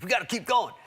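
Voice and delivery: mock movie hero rallying himself; Bruce Willis. Movie hero jumping out after accident, mock-urgent. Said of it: "like Bruce Willis is like".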